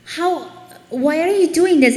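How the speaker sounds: high, loud outrage with a laugh in it; mock indignation. Acting out Habakkuk challenging God, mock indignant.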